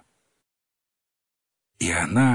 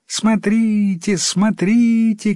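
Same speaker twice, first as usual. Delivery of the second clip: put-on high, drawn-out children's cries; children calling out; 8 semitones higher, slower than usual. A narrator's reading. Village children spot the flying frog, high drawn-out calling. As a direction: call out high and slowly like village kids. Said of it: "кричали дети в одной деревне".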